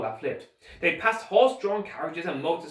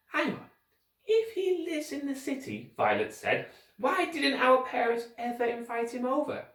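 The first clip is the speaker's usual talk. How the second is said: put-on girl voice; 10 semitones higher than usual. Violet voiced higher.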